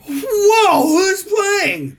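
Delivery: high-pitched, hammed-up wow; excited reaction. Friend offered hockey tickets as a test, excited. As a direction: react thrilled to free tickets.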